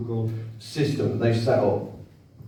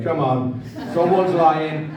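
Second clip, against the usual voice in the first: laughing jibe; 2 semitones higher, slower than usual. Retold or imitated.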